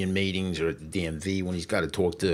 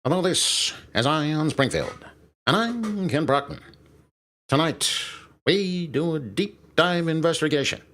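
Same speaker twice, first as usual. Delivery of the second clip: raised, projected TV anchor delivery; Kent Brockman; 7 semitones higher than usual. Acted. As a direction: announce it like a pompous cartoon newsreader. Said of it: "anchor man".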